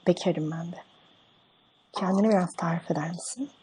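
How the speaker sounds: soft, quiet put-on woman's voice; man playing a woman softly on the phone; man doing a woman's voice. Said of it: "Oğlum ben kadın sesi yapamam ki".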